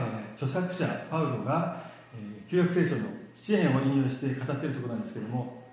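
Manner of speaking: ordinary talk, nothing marked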